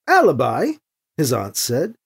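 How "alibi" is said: "Alibi" with a rising question tune. A narrator's reading. His aunt says "Alibi" to him as a question. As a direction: turn "Alibi" into a question, voice lifting at the end. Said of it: "his name a question".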